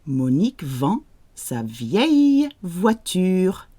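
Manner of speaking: slow, clear model sentence; slow model reading. Shown as a demonstration. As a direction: read it slowly for students to repeat. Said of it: "So you say it out loud".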